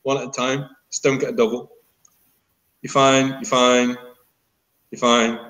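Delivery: normal talking voice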